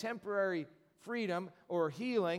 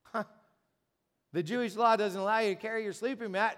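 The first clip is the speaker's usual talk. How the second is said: normal talking voice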